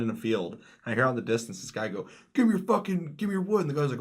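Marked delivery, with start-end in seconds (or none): 2.3-3.6 high, loud imitated yelling; mock robber demand. Bandit robbing a player of wood, yelling. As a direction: holler it like a frantic video-game bandit.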